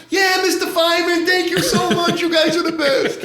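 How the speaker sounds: very high, gushing mock thanks; in-character wino. Fake wino thanking the returning firemen, hammed-up high gush. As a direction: gush it high, laughter creeping in. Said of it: "you're way in character".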